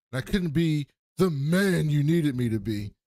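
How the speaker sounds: loud, slow, mock-tragic declaration; a nineties rapper posturing while pouring his heart out; hammed-up dramatic confession. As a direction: ham it up like a soap opera confession. Dramatic drunk confession to uncle.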